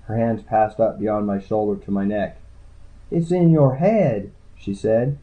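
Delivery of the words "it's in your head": high, slow backwoods healer; high-voiced, drawn-out discovery; entranced healer. A narrator's reading. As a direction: draw it out high as if sensing it. Healer searching him, in trance.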